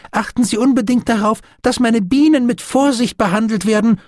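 high, urgent, quickening plea; pleading. A narrator's reading. Doctor begs officer to handle his bees carefully, pleading. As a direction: implore him in a high, anxious-to-be-heard voice. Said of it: "flehte Stephen".

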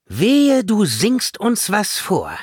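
loud, high, drawn-out threat; dreading, bossy warning; exasperated warning. A narrator's reading. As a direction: warn sternly against any singing. Villainess warns the cute fairy.

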